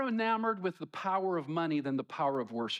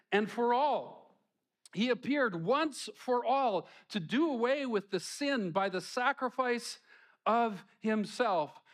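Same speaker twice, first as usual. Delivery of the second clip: raised, measured reading of scripture; 6 semitones higher than usual. Acted. Preacher reading verses to the church, raised and measured. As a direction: proclaim the verses with weight.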